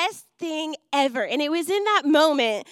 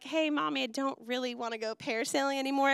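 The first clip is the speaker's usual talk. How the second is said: put-on calm child; 8 dB quieter, faster than usual. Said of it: "I don't mean just like".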